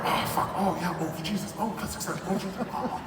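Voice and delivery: soft, put-upon groans of dismay; mock groaning. Imitating himself at work, soft mock groans. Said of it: "I could turn up and go".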